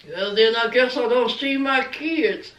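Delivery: low, deep mocking imitation of Sean. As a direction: drop the voice low to mock him. Mimicking Sean's reply.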